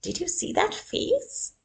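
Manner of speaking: high, breathy mocking poodle; high snooty poodle voice. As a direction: sneer it like a pampered poodle. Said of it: "A pampered poodle chuckled".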